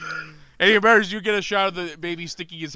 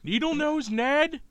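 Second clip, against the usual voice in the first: Ned Ryerson bit; 8 semitones higher than usual. Retold or imitated. Quoting Groundhog Day's Ned Ryerson.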